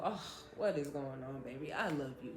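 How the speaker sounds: imitating a tender stepdad. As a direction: soften right down, comforting.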